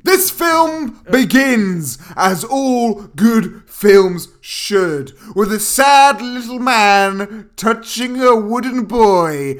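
loud, high, drawn-out showman narration; theatrical film recap. Acted. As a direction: announce the plot with grand, slow emphasis.

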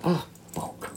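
voiceless curse, barely audible; breathed curse. Real, not acted. After burning himself, a voiceless curse. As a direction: let it slip out on a breath, almost silent.

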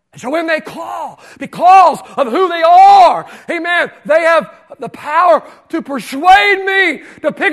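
fervent sermon climax; preaching at full force. Acted. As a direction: drive it home to the church.

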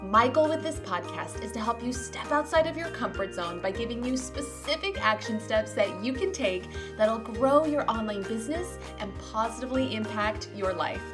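usual speaking voice

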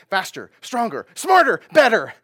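rising to a loud, high ad shout; commercial slogan voice. Pretend. Preacher parodying a commercial, hyped ad shout. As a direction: hype it up like a TV ad. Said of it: "you've heard this on commercials".

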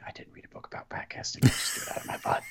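hushed joking whisper; whispered aside. Real, not acted. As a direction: whisper it like a secret confession.

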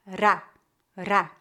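"Ra" with a single tap of the tongue tip; tapped R. Shown as a demonstration. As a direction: flick the tongue tip once on "ra". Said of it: "vibração da ponta da língua".

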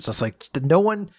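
ordinary talk, nothing marked

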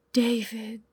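soft, reverent whisper. A narrator's reading. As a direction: breathe the name in awe. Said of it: "he whispered, almost reverently".

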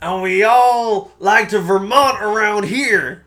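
high, loud, slow Vermont parody; hammed-up Vermont accent. Shown as a demonstration. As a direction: stretch it out in a hollered mock Vermont accent. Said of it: "the difference in tones that I had there".

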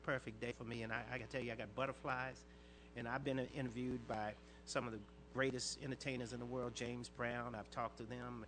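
normal talking voice

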